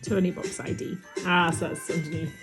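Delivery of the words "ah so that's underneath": normal talking voice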